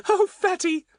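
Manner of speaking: very high, admiring little voice; excited Betts, delighted. A narrator's reading. Betts to Fatty about his story, delighted high voice.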